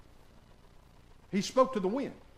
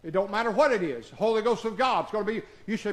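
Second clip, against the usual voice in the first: usual speaking voice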